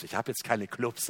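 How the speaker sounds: normal talking voice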